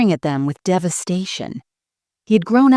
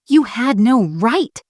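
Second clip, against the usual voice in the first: lightly acted shriek, higher and slower; a restrained narrated shriek, higher; mock shriek; 6 semitones higher, slower than usual. A narrator's reading. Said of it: "she shrieked".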